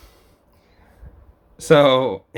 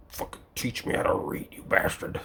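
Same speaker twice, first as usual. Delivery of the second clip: harsh whispered threat; whispered demand; 6 semitones lower, 9 dB quieter than usual. Retold or imitated. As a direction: hiss it under breath.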